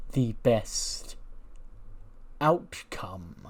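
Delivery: slow villain proposing an alliance; ominous cult leader. Acted. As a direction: draw it out with deliberate pauses. Plays Gacy in a story scene.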